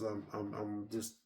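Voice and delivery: soft, low, stammering exhaustion; exhausted. Retold or imitated. Retelling the jock giving up, soft low exhausted stammer. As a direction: stammer like you're spent.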